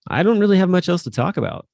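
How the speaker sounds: high, bright giving-up line; amused. Real, not acted. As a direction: laugh it off as you run dry. Winding down, laughing.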